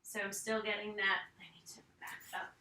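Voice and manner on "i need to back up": whispering. Real, not acted. Aside while exercising.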